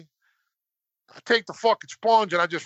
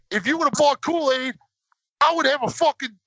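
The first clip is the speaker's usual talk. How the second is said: higher, heated rant at mom; angry kid outburst; 8 semitones higher than usual. Retold or imitated. Nine-year-old blames his mom for the cheap drink, angry outburst. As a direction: blame her, furious and close to tears. Boy yells at mom over sponge.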